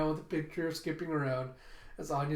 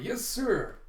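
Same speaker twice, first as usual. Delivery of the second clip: high-pitched dutiful chirp; eager pupils; 7 semitones higher, 3 dB louder than usual. Acted. Class responding to the teacher's instructions, eager pupils. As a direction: answer the teacher keenly.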